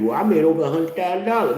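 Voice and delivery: high, smug mock bragging; mock brag. Mocking a bragger about his income, high. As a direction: pitch it up and show off.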